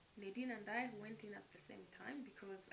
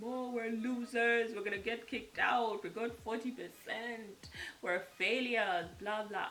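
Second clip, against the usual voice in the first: slow, louder hammed-up lament; mock wailing; 8 dB louder, slower than usual. Telling her brother they failed, slow mock wailing. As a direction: drag it out like a self-pitying moan. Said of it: "we stopped crying".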